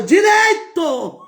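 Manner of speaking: high, loud, drawn-out shout; slow, high shouts of faith; shouting from the crowd. Retold or imitated. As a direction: yell it out across the crowd. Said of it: "eu gritava".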